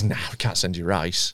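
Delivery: lightly voiced grumpy takeaway guy; takeaway man refusing. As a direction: drop it low and flat for his refusal. Retelling the takeaway man's refusal.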